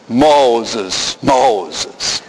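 low, breathy booming echo; movie God voice. Retold or imitated. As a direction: intone it like the film. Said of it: "movie says".

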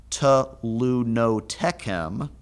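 "telunotechem" said very slowly, syllable by syllable; careful slow pronunciation. Shown as a demonstration. Teacher sounding out a long Hebrew word, slow and careful. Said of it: "it's a mouthful".

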